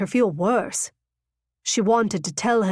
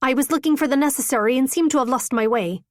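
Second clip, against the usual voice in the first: nervous rush; 4 semitones higher, faster than usual. A narrator's reading. Nervous excuse, rushed.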